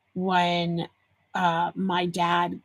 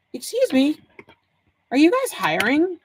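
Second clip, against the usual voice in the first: higher-pitched polite customer question; retold sweet inquiry, pitched up; mock-kindly; 6 semitones higher than usual. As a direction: ask in a lightly put-on polite voice. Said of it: "I kindly said to him".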